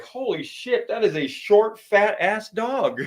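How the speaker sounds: high-pitched, half-laughing disbelief; squeaky astonished gasp at an ugly dog; amazed exclamation. Retold or imitated. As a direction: blurt it out like you can't believe your eyes. Reacting to seeing the dog.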